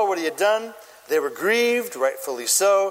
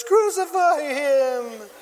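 high, slow mock shout; imitated crowd cry; 8 semitones higher, much slower than usual. Crowd answers the king's question, imitated drawn-out cry. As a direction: stretch out a mock mob's chant. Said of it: "And the crowd says".

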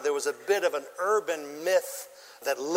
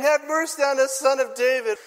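crying out; 6 semitones higher, 4 dB louder than usual. A narrator's reading.

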